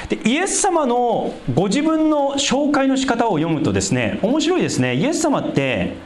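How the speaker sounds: ordinary delivery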